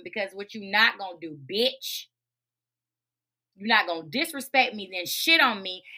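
normal talking voice

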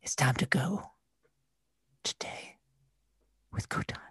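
soft dramatic whisper, fading. Pretend. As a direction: breathe it close to the mic. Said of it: "was that a deliberate whisper".

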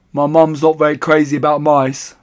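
put-on high, loud boy character; young boy's voice. A narrator's reading. Boy Bruno's dialogue, narrator voices him.

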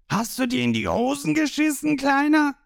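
high, jeering question; taunting villain. A narrator's reading.

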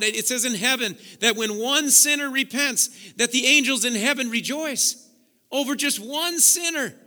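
loud, soaring preacher's call; preaching at full force. Acted. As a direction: preach it out to the whole church. Preaching to the congregation.

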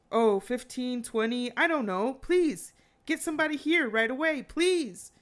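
high, loud, pleading imitation of a panicked caller; acting out a desperate emergency call; frantic. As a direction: beg urgently for help, pitch climbing. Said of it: "sounds even more pract-, uh, frantic".

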